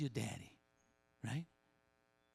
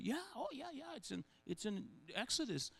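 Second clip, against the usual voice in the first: higher, softer know-it-all "yeah"s; mimicking eager crowd; 7 semitones higher, 6 dB quieter than usual. Pastor mimics crowd boasting it knows Exodus, higher and softer. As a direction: toss off the answer like a smug pupil.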